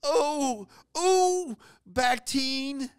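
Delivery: high, pained squeaky voice; burned friend. Retold or imitated. Burned friend begging coworkers for salve, high pained squeak. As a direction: squeal for ointment through the sting. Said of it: "And he was saying".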